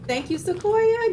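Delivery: high, loud, slow gushing thanks. Real, not acted. Thanking Sequoia for the blanket, high slow gushing. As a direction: stretch the thanks out, delighted.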